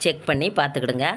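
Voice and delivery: usual speaking voice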